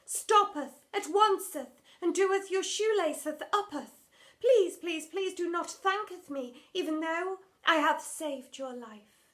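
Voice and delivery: high, loud, overwrought stage voice; hammed-up old-English drama queen; theatrical Shakespearean lament. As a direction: wail it like a tragic heroine on stage. Drama teacher to a girl.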